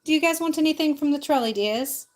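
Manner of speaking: a lightly put-on British accent; British trolley witch. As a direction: play the British trolley lady offering her sweets. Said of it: "speaking with a British accent".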